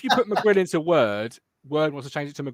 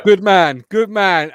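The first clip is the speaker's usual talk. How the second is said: cheering; 2 semitones higher, 10 dB louder, slower than usual. Real, not acted. Cheering Lewis leading the race.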